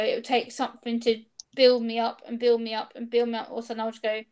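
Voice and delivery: normal talking voice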